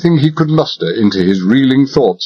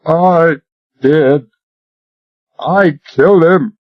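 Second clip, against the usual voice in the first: moaned confession; 6 semitones higher, slower than usual. Acted. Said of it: "Royal moaned".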